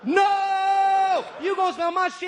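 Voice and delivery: high, loud mock-outraged yell; drawn-out comic shout; shouted refusal. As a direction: holler it at the top of your voice.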